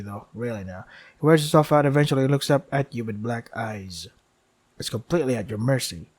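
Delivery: steady storyteller reading of the game text; game story narration. Acted. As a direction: narrate it like a dark fable.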